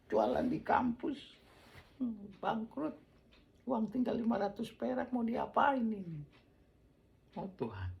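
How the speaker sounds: high, loud cry of despair; high anguished exclamation; desperate outcry. Retold or imitated. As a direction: wail it out like a broke young man at his wits' end. Retold despair over last money.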